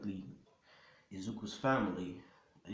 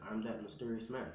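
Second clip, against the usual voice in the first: ordinary delivery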